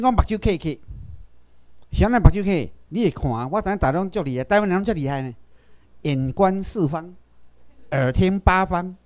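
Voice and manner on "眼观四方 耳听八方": slow, even recital of the saying; slow proverb. Acted. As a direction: lay out each half of the saying slowly. (慢慢仔念俗语)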